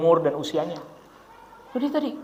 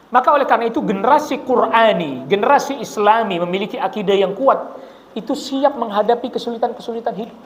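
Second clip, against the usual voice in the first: normal talking voice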